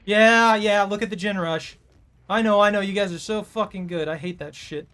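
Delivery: ordinary talk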